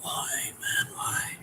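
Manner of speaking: breathy, anguished why; despairing whisper. Real, not acted. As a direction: plead to the heavens in despair. Despair at conceding.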